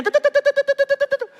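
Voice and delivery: high, rapid-fire rattle; machine-gun string of high syllables; imitated rapid chopping. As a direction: mimic a fast, relentless tapping noise. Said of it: "能听见动静".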